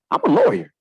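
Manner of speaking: LAWYER punched high and loud; emphatic. Real, not acted. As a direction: say "lawyer" way up, with force.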